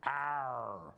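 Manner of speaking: lightly voiced pirate Arr; a hint of an old sea dog; pirate growl. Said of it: "He said, "Repeat it".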